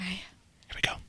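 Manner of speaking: whispered aside. Real, not acted. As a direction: breathe it as a whisper. Host whispering before the accent.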